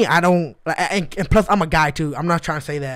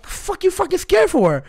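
very high disbelieving squeal; mock incredulous; 13 semitones higher than usual. Retelling reply to scared coworker, very high incredulous. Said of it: "I was like".

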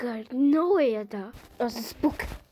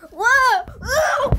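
sudden loud, high yelp of fright; real scared whoa; startled yelp; 8 semitones higher, 8 dB louder than usual. Real, not acted. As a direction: jump out of your skin. Gamer startled by a jumpscare.

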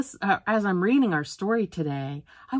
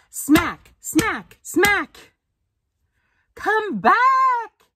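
rising, louder and higher with each smack; yelling lunch lady; 6 dB louder, much slower than usual. A narrator's reading. Lunch lady chasing the fly, shouting and swatting. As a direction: climb higher and louder, shouting come back. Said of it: "she yells".

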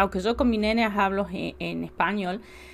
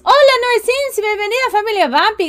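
cheery welcome; 10 semitones higher, 7 dB louder than usual. Real, not acted. Da la bienvenida al seguidor.

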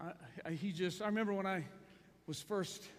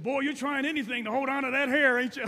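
loud, higher-pitched ribbing; hard old colonel; 7 semitones higher, 11 dB louder than usual. Retold or imitated. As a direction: imitate a tough pastor needling a young man. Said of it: "He was hard".

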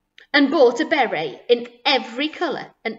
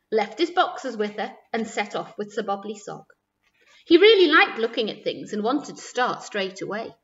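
ordinary delivery

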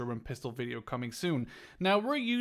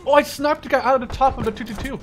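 excited; 9 semitones higher, 9 dB louder than usual. Real, not acted. Thrilled after a snipe.

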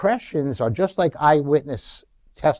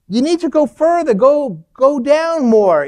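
high, loud call over a balcony; urgent shouted instruction; retold yell; 11 semitones higher, 6 dB louder than usual. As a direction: yell down to someone below, pitched way up. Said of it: "I yell, I go over the".

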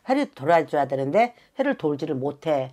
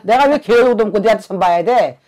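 louder, cursing refusal; mock angry retort; 5 semitones higher, 7 dB louder than usual. Shaman voices the client's old self cursing a shaman, louder and angry. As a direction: snap back like her old feisty self. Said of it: "옛날 같으면".

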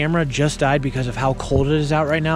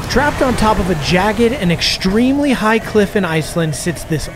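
loud, slow trailer narration; dramatic announcer; 5 semitones higher, 7 dB louder than usual. Acted. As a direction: build suspense like a movie trailer. Dramatic intro of next challenge.

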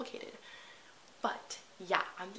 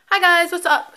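much louder and higher cheery hello; loud greeting; 10 semitones higher, 18 dB louder than usual. Real, not acted. Vlogger greeting viewers, loud and high-pitched hello. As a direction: call out a big bright hi to viewers.

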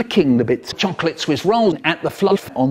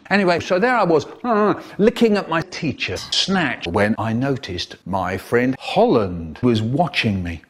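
usual speaking voice

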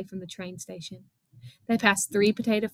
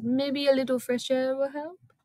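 higher pitch, climbing toward the end; Missus Jeepers' character voice; 5 semitones higher than usual. A narrator's reading. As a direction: offer it with a rising, suggestive lilt. Missus Jeepers offers help in character.

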